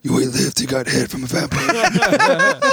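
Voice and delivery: lightly imitated actor voice, slowed; a hint of a cheesy movie line; Eddie Winslow voice. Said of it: "his voice. It's so terrible".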